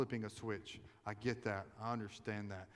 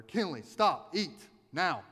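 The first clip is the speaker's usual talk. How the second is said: sharp, loud, higher-pitched commanding shout; retold yell; 7 semitones higher, 10 dB louder, slower than usual. Dad yells at his little daughter at dinner, loud sharp shout. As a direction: bark the order like an angry dad at dinner. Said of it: "and I just yelled at her".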